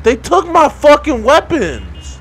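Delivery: high, loud, drawn-out complaint; indignant loud whine; outraged whine. Real, not acted. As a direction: wail it like you were robbed. Robbed of weapons, outraged.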